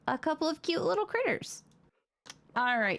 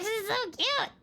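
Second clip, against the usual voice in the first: squealing high over cute pet; overwhelmed by cuteness; 13 semitones higher than usual. Real, not acted. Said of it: "I can't handle how cute".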